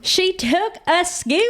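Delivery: high, mocking singsong repeat; teasing. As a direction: tease her by parroting it. Teasing repeat of friend's words.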